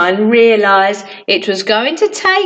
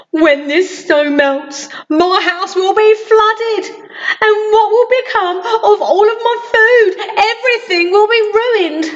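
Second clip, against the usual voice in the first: fretful greedy man; 8 semitones higher than usual. Acted. Mister Greedy frets about flooding.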